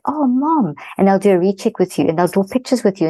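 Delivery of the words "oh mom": lightly voiced child, pitched higher; a higher, mildly put-on kid's exclamation; imitating kids. Kids coming up to their mother, lightly imitated in a higher voice. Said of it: "those kids will come up and say".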